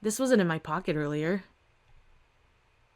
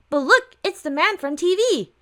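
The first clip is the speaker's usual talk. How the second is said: put-on much higher, eager little kid; excited child; 9 semitones higher, 4 dB louder than usual. Little girl pointing at the man from TV, high and eager. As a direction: squeal it like a small child tugging mom.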